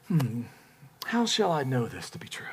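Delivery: hushed, mock-skeptical question; doubtful whisper. Zechariah questioning the angel's good news, doubtful whisper. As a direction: whisper it as if privately unconvinced.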